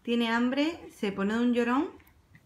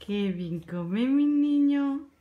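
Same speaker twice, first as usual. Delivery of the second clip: slow fond praise; 4 semitones higher, 4 dB louder, much slower than usual. Real, not acted. Mother praising her baby as he eats, slow and doting.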